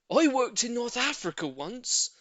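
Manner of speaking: high, loud tale; boastful neighbour. A narrator's reading. Neighbour bragging to the lady, loud worldly. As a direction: sound worldly. Mr Hoppy voiced.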